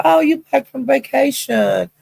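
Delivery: high-pitched gushing welcome; excited greeting. Retold or imitated. Lolo welcoming a friend back in chat, high gushing greeting. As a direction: greet them in a high singsong. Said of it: "And she said".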